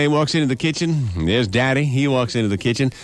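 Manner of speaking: ordinary talk, nothing marked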